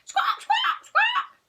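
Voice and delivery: very high, loud squawks; piercing chicken impression; cackling chicken. A narrator's reading. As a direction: screech like a frantic hen. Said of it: "cackled Margaret".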